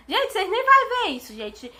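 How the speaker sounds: high, loud burst to the viewers; high excited aside to the audience; emphatic reassurance. Real, not acted. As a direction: insist brightly that nobody will notice.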